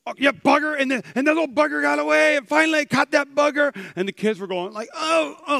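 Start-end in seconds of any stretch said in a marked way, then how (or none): 0.1-3.7 high, loud, excited storytelling; oblivious visiting preacher. Retold or imitated. American friend preaching to shocked Canadian kids, loud oblivious enthusiasm. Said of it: "he was like". 4.9-5.6 high mock-wounded ohs; pained "oh". Kids hearing a swear word in church, high pained ohs. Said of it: "like it hurt them".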